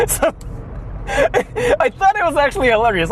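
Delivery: real laughter breaking through the words; can barely get it out for laughing; high giggling, words squeezed through laughter. Real, not acted. Cracking up at the story.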